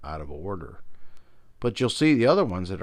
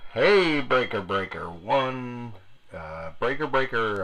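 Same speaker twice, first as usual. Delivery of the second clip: radio call; 3 semitones higher than usual. Pretend. Calling out over walkie-talkie.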